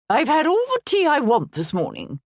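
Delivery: brusque dowager waving off tea; hammed-up imperious aunt; Aunt Henrietta. A narrator's reading.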